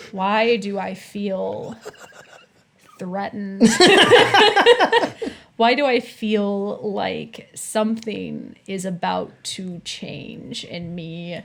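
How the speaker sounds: slow, drawn-out dramatic dread; hammed-up sense of doom; mock-ominous foreboding. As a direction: draw it out like impending doom, playfully.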